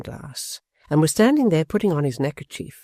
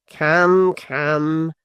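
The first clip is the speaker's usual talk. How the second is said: louder then settling, teasing; mock soothing; 4 dB louder than usual. A narrator's reading. Quilp to his mother-in-law, mock soothing. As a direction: pretend to calm her. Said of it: "wilfully misunderstanding her".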